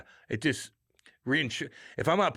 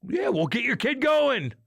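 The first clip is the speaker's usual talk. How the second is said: pushy hockey dad; 10 semitones higher, slower than usual. Retold or imitated.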